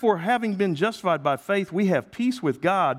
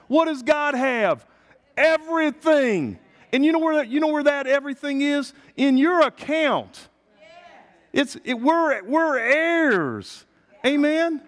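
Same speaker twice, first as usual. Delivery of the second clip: full-force preaching; 11 semitones higher, 4 dB louder than usual. Acted. Pastor rousing the congregation, high and loud. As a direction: rouse the room with it.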